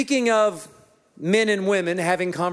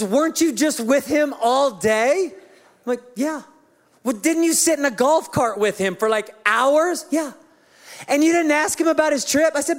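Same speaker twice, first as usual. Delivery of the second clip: higher, incredulous wife voice; exasperated wife; 8 semitones higher than usual. Retold or imitated. Pastor voices his wife grilling him about the trip, higher and exasperated. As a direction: press him with each question. Voicing wife's questioning.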